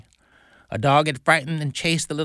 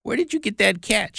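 mild mock shout, pitched up; 7 semitones higher than usual. A narrator's reading. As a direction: demand it in a high voice, without real volume. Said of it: "shouted her mother".

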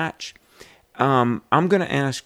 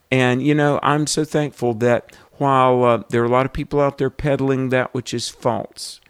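usual speaking voice

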